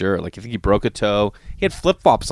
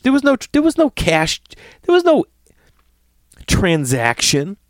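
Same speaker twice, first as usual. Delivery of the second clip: climbing incredulous rant; rising outrage; 8 semitones higher than usual. Real, not acted. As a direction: build into a squeaky indignant peak. Outraged about no transaction.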